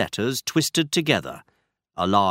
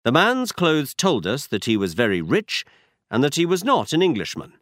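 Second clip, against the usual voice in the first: plain reading voice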